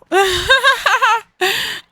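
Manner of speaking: high, loud staccato cackle; odd laugh. Real, not acted. Player losing it over Nathaniel, high odd cackle. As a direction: burst into a high rattling laugh. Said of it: "I don't know why I'm laughing like this".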